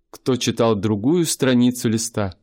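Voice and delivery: ordinary talk, nothing marked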